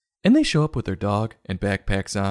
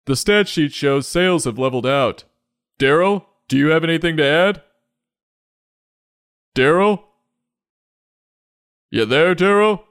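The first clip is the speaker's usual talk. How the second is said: high, loud office-manager voice; hammed-up corporate boss; meeting boss; 8 semitones higher, 5 dB louder than usual. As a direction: call for a silent coworker on a conference call. Boss on conference call.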